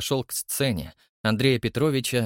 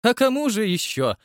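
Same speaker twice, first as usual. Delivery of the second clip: high, emphatic exclamation; восклицание; 11 semitones higher, 3 dB louder than usual. A narrator's reading. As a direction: exclaim it on a much higher pitch. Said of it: "воскликнул". (восклицая, высоким голосом)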